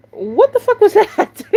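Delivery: what did I just witness; stunned disbelief. Retold or imitated. Cashier turning to her friend after the odd customer, stunned.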